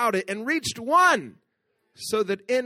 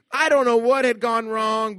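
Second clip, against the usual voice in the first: usual speaking voice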